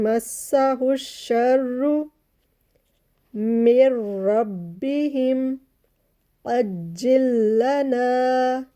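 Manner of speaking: मस्साहु शर्रु with the doubled स and र pressed hard together; slow tajweed recitation; strong shadda. Shown as a demonstration. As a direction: chant slowly, gripping each double letter. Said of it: "मजबूती से strongly मिलाते हुए".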